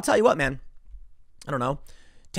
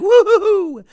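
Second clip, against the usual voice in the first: hammed-up woo-hoo-hoo, much higher and very loud; mock celebration whoop; 11 dB louder, slower than usual. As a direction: whoop like partygoers cheering a win.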